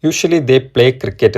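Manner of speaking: each word drawn out very slowly; slow dictation reading. Shown as a demonstration. Teacher reading the example sentence to students, very slowly. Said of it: "ഇവിടെ കൊടുത്തിരിക്കുന്ന sentence".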